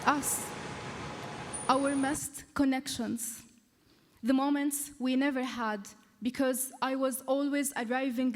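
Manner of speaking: deliberate spoken-word delivery, phrase by phrase; poem recital. Acted. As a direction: perform a heartfelt poem with weighted pauses. Reads own poem aloud.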